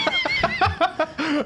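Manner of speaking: sudden high laugh; burst of laughter. Real, not acted. Laughing at the banter.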